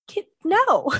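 high, loud, fed-up "no way" to the therapist; loud mock-exasperated brush-off; exasperated refusal. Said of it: "I get so frustrated".

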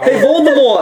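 high teasing shout; mocking call. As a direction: yell the nickname to tease. Classmates calling out the nickname.

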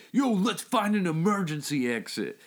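smooth flashy pimp swagger. Retold or imitated. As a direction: slick street pimp, laid back. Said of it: "Your pimp voice is amazing".

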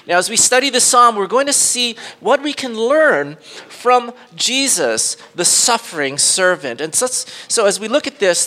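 ordinary delivery

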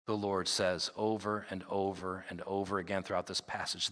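normal talking voice

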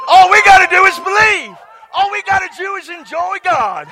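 high, loud revival shout; exuberant preaching. Real, not acted. Preacher urging the church to believe and enjoy God, exuberant shout. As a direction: shout it joyfully to the congregation.

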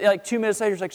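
ordinary talk, nothing marked

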